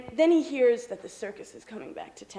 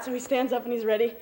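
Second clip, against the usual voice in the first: usual speaking voice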